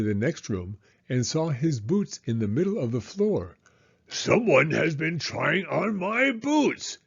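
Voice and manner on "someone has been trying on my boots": slow, higher booming papa bear; booming big bear voice. Acted. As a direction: boom it like a big cross bear. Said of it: "he boomed in his big bear voice".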